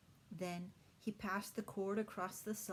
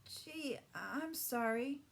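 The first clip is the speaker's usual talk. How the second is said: high, slow, timid apology; a scared boy owning up; a little frightened; 7 semitones higher, much slower than usual. A narrator's reading. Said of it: "a little frightened".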